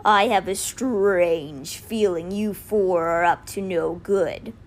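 slow, drawn-out cheetah voice; big cat character. A narrator's reading. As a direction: speak as a big cat, taking your time. Giant cheetah speaks in the story.

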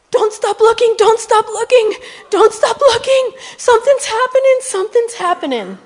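high, loud, urgent cries; frantic retold plea. Old woman to the stranger praying for her, imitated frantic high shouting.

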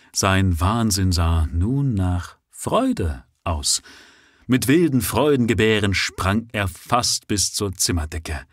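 usual speaking voice

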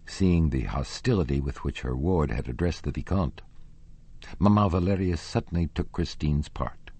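usual speaking voice

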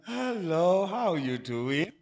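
low, slowed put-on greeting; drawn-out mock hello, sinking low; a second, different voice put on; changed God voice. Shown as a demonstration. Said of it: "Because one time it's".

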